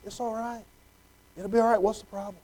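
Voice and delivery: soft, hushed comfort; tender reassuring whisper-soft tone; soothing parent. Retold or imitated. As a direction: hug a scared child with your voice. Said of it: "grab 'em up and to hug 'em close".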